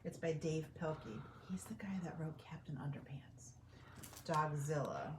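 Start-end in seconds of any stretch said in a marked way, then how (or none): 1.5-3.5 unvoiced aside about the author; whisper. Real, not acted. Aside to children.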